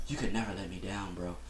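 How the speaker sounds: mock a soft, pleading star athlete, barely voiced; hushed mock-sentimental quote; soft-voiced LeBron James. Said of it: "Why did your voice go up an octave".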